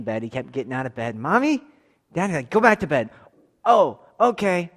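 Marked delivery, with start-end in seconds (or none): 1.2-2.3 put-on high child voice calling out; high, loud kid calling mommy and daddy; child calling parents. 2.5-3.1 parent ordering back to bed. Retold or imitated. Parent telling kid to bed. 3.6-4.7 put-on child's reply. Kid's slow reply.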